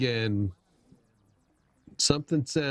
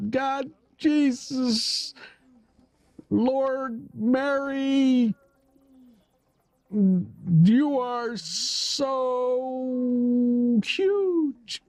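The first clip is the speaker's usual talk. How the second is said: high, slow, drawn-out mock rapture; hammed-up ecstatic praise; 11 semitones higher, much slower than usual. Streamer keeps the joke going, high slow mock rapture. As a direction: overplay a breathless swoon, very high. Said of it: "It's not that kind of stream".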